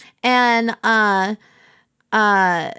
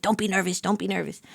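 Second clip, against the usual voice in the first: fast, hushed pep talk to yourself; quiet self pep talk; 5 semitones lower, 8 dB quieter than usual. Retold or imitated. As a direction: psych yourself up under your breath. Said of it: "I, like, rally myself".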